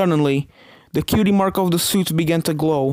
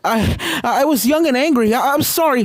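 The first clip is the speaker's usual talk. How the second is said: high, loud frantic panting apology; panicked pleading; 9 semitones higher, 3 dB louder than usual. A narrator's reading. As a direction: beg in breathless panic. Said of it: "Starlight started to hyperventilate".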